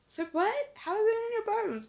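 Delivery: high, loud incredulous cry; shocked disbelief. Retold or imitated. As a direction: blurt it out in total disbelief. Shocked by mum's cancer news.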